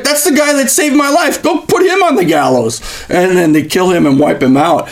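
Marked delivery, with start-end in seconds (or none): 0.0-2.8 much higher, hammed-up royal outrage; mock furious king, voice jumped way up; indignant king. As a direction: play the king aghast, then commanding. King realising, ordering.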